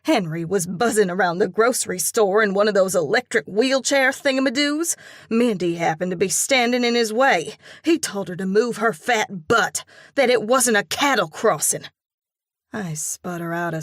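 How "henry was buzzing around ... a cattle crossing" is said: fast, loud indignant telling; outraged. A narrator's reading. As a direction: rush on, coated with outrage. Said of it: "her voice coated with outrage".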